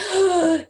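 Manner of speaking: sharp, high gasp of sudden terror; terrified gasp. Retold or imitated. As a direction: gasp as if the energy just shifted in the room. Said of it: "it'd be like".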